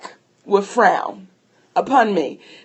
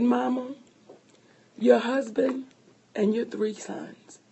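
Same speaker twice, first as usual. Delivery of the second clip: calm; 9 dB quieter than usual. Retold or imitated. Husband consoling his crying wife, very calm and soft. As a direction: imitate a patient husband talking her down quietly. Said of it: "this is just how calm he's talking to me".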